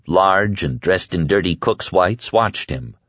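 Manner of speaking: ordinary delivery, nothing marked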